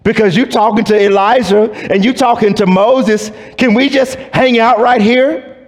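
high and eager, starstruck; giddy Peter. Retold or imitated. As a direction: Peter gushing, not wanting the moment to end. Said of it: "Peter is like".

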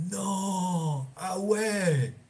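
plus haut et plus fort, étonné; surprise imitée. Retold or imitated. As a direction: joue la découverte soudaine. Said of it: "J'ai fait". (surprise jouée)

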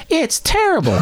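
high scoffing verdict; mock disgust. Recalling rewatching Broken Arrow, high mock verdict. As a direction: toss it off in a squeaky mock voice. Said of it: "I'm like".

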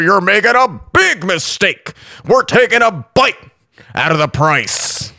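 slow, drawn-out radio ad voice; car sale ad. Pretend. Comic parodies a local Toyota radio ad in slow pitchman voice.

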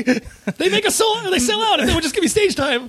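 high, laughing mock plea; mock panicked apology. Comic owning up to the celebrity he mocked, high mock-pleading.

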